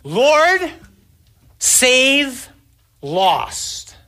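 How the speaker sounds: high, loud yell, one drawn-out word at a time; demonstrated desperate shout, falling on the last word; screamed over a storm. Shown as a demonstration. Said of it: "here's how you have to say it".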